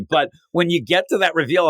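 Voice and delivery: ordinary delivery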